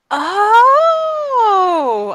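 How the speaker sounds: high, long, loud squeal of delight; thrilled realisation. Acted. Girl learning where they're going, thrilled squeal. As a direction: let a big high "oh" swoop down. Cece reacts to Goblin Market news.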